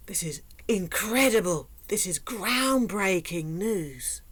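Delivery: sarcastic gasp, then slow overblown awe; mock amazement. Mocking her own obvious advice with fake astonishment. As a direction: ham up the wonder sarcastically. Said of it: "sarcastic mock amazement".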